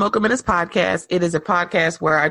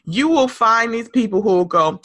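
normal talking voice

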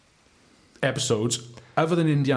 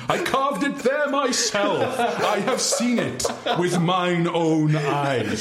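higher, slow, laughing proclamation; pompous general voice; 7 semitones higher, slower than usual. Acted. Host reads the unpicked general quote in a hammed-up voice. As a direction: proclaim it like a deranged general, cracking up. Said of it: "The paedophile general quote that you didn't pick were".

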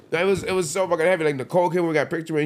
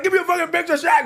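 very high, loud yelp; shouted fan plea, pitched way up; overexcited fan demand; 5 dB louder, faster than usual. Retold or imitated. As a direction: beg loudly for it, starstruck. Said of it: "I'm like".

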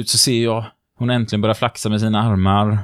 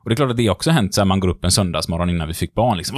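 ordinary talk

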